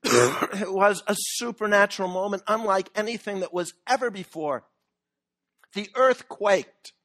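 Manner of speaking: ordinary talk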